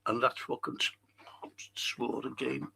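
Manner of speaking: whispered guilty aside; real sheepish whisper to himself. Real, not acted. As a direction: whisper it under your breath, caught out. Caught himself swearing on stream.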